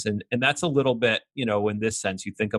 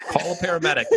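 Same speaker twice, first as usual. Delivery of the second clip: very high, laughing imitation; mock cry for help; faster than usual. Mimicking a bystander after the shooting, mock cry for help. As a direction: squeak it out as a bystander.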